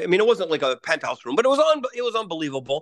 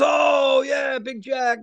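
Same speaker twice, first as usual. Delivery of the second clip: mock booming welcome, stretched slowly on the name; hammed-up friendly shout, higher and slow; excited greeting; 6 semitones higher, much slower than usual. Uncle's friend greeting on phone.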